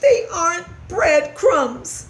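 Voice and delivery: high, slow mouse voice; pleading little mouse; squeaked. A narrator's reading. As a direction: squeak the correction loudly. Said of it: "squeaked Christopher".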